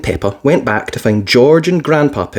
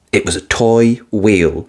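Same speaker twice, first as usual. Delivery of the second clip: ordinary delivery, nothing marked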